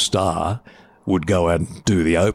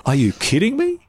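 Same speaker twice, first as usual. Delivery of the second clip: high-pitched retold disbelief; acted-out astonished protest; incredulous; 12 semitones higher than usual. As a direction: challenge him, voice jumping up. Retold disbelief at star leaving.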